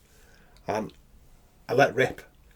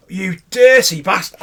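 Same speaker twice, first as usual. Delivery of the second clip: mock accusation; 5 semitones higher, 5 dB louder, slower than usual. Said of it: "And I'm going".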